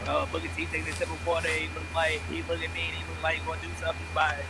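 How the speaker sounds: rattled off fast on one level note; deadpan play-by-play, fast; monotone ringside call; fast monotone commentary. Retold or imitated. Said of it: "the same monotone voice".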